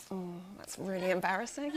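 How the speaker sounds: soft, laughing mock pity; mock embarrassed reply. Friends reacting to the film title, laughing softly. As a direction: play the friends' polite cringe. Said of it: "and them going".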